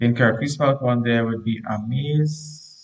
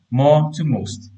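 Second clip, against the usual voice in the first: More to most, with MORE said higher and louder and drawn out slowly; teacher stressing the correction More to most; 4 semitones higher, 5 dB louder, slower than usual. Shown as a demonstration. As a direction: say it slowly and lean hard on More, then most. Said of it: "So this more needs to be changed to most".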